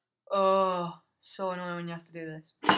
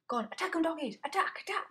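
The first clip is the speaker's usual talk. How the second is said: high excited urging; 10 semitones higher, 3 dB louder, faster than usual. Real, not acted. Urging dogs to fight.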